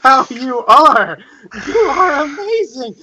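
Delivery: high, eager fanboy praise; gushing admiration. Acted. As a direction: rave about him, starry-eyed. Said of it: "the most reverent tones".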